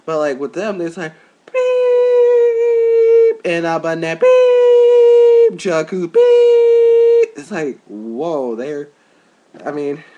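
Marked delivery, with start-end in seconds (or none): 1.4-3.4 long, very high, loud bleep; imitated censor bleep. Imitating the show's censor bleeps, high and loud. 4.2-5.6 long, very high, loud bleep; imitated censor bleep. Imitating the show's censor bleeps, high and loud. 6.1-7.4 long, very high, loud bleep; imitated censor bleep. Imitating the show's censor bleeps, high and loud.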